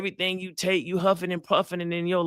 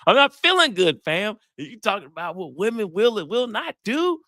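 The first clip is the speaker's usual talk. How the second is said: mock-exasperated, high-pitched complaint; indignant protest; 8 semitones higher, 3 dB louder than usual. Sick man protesting to his date, high and indignant. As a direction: play up the wounded disbelief.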